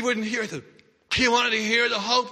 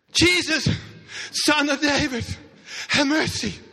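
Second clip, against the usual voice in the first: desperate cry; 5 semitones higher than usual. Acted. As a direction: cry out over the crowd. Beggar crying out to Jesus.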